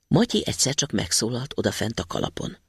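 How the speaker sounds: plain reading voice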